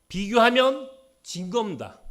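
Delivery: declared loud and high; ringing preacher's verdict; loud proclamation. Acted. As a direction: proclaim it to the room. Loud then soft repeat.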